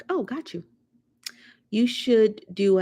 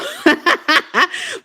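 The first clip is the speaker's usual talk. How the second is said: loud high cackle; high burst of laughter; 12 semitones higher, 8 dB louder than usual. Real, not acted. Vlogger laughing at a viewer comment.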